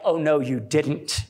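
drawn-out sassy "Oh no, you didn't"; slow, low sassy disbelief. Shown as a demonstration. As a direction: stretch it with full attitude. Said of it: "It's gotta be more like".

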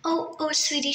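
ordinary talk, nothing marked

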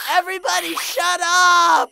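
loud, fed-up yell; furious shout. Acted. Fluttershy yelling at the creatures, furious shout. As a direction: snap that you have had enough.

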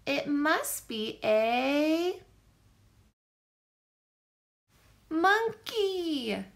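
slow build to a triumphant answer; excited guess. A narrator's reading. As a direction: drag it out, then land the word. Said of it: "I shouted".

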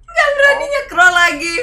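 high, loud wailing shout; crying shout. Acted. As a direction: wail it out like a crying kid. Berteriak sambil menangis. (teriakan sambil menangis)

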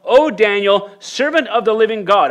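high, loud anguished call to Daniel; anguished cry. Acted. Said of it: "He cried out in a tone of anguish".

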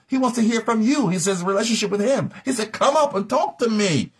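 high, urgent, incredulous remonstrance; impassioned preaching. Real, not acted. Preacher chiding those who pray by proxy, high impassioned challenge. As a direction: drive the questions out high and hard, barely letting up.

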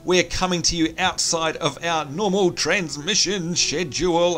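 ordinary delivery

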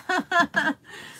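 high-pitched giggle; light falling giggle; laugh. Real, not acted. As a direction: let out a short, high laugh. Laughs after her own remark.

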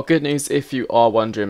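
ordinary delivery

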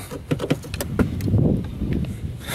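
soft, breathy run of chuckles; quiet laugh. Real, not acted. Amused by the gas door opening, quiet laugh. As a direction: laugh softly at the absurdity.

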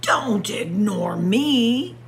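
ordinary delivery

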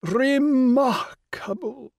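"Remarkable" drawn out slowly, high and regal, as if capitalised; dwelling emphatically. Acted. The Rani echoing Will, giving "Remarkable" slow capital-letter weight. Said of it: "dwelling emphatically on the spoken equivalents of ... capital letters".

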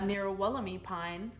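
ordinary delivery, nothing marked

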